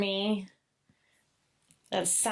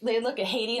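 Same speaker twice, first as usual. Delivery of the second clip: ordinary delivery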